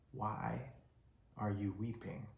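hushed and tender, asking why she weeps; almost a murmur, tender; soft gentle question. Retold or imitated. Jesus gently asking Mary why she weeps, hushed.

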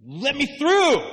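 high, loud demand; impatient pushing. Acted. A believer pressing at the door, loud and high. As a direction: shove your way forward.

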